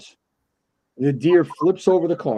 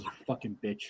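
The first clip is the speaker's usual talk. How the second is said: very soft, low retold curse; upset muttered insult; 4 semitones lower, 14 dB quieter than usual. Retelling his reply to her deer joke, quietly upset. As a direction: curse her under your breath, low. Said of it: "I was genuinely upset with this girl".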